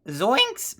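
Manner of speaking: a light try at Shaggy with a voice crack. Retold or imitated. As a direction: reach up for a cracking Shaggy squeak. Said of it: "I got the voice crack".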